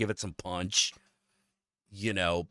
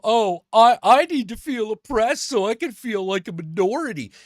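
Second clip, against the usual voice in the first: hammed-up mocking whine, louder; mocking whiner; 5 semitones higher, 4 dB louder than usual. Mocking the guy who wants to feel oppressed, hammed-up whine. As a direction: sneer at them in a louder whiny voice. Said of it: "You know".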